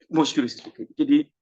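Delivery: ordinary delivery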